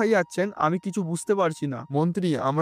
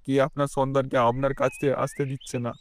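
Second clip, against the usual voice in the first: ordinary delivery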